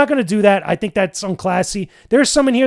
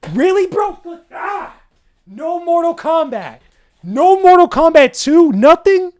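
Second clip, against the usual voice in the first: high, loud disbelief, voice cracking up; real outrage at the list, pitched way up; incredulous outburst; 8 semitones higher, 5 dB louder, slower than usual. Real, not acted. Ranting to viewers about a missing game, incredulous high yell.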